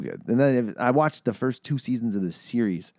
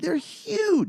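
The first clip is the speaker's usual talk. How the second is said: put-on voice. Repeated in put-on voice.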